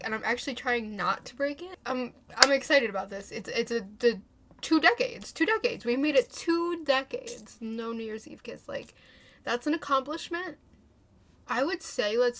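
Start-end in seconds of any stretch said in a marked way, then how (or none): 4.6-7.6 mock proud. Real, not acted. Mock pride in streak.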